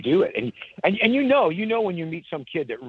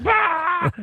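a short, loud, high burst of a belly laugh; imitated belly laugh; 4 dB louder than usual. Country star bursts out laughing at the memory, a loud high laugh. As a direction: bark out one big laughing "Ah!". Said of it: "he just started belly laughing".

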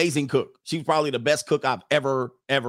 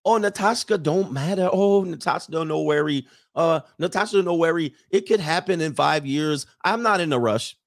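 higher, imitated foreign girlfriend's accent; Natasha's accent; 3 semitones higher than usual. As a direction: mimic her accented English, pitched up. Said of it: "in her accent".

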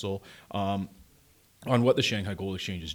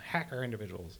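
softer, fogeyish take on "Hacker individuals."; old-timer voice; 10 dB quieter than usual. Shown as a demonstration. Mocking himself to his co-host as an old man. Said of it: "I'm just, I'm just so old, I'm like".

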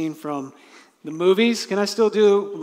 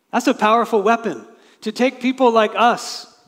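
proclaimed; 7 semitones higher, 8 dB louder than usual. Real, not acted. Preacher exclaiming over Christ's sacrifice.

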